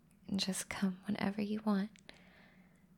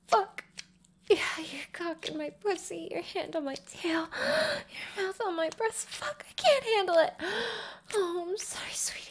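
climbing higher, losing control; overwhelmed; 9 semitones higher than usual. Acted. Overwhelmed with pleasure.

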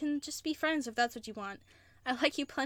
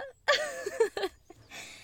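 triumphant laugh. Acted. Laugh after catching partner.

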